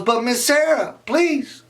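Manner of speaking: very high whiny plea; pleading kid. Acted. Raymond begs the teacher, very high whine.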